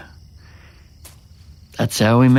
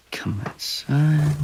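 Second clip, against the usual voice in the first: slow, softer hushed invitation; a slow secretive whisper, softer; 3 semitones higher, 6 dB quieter, much slower than usual. Acted. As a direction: draw her outside in a whisper. Inviting her on camera.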